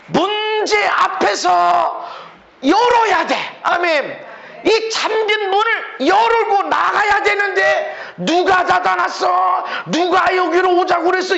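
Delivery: high, stretched exhortation; impassioned pulpit cry; fervent preaching shout. Real, not acted. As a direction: cry out to the congregation with urgency. Preaching fervently against blame.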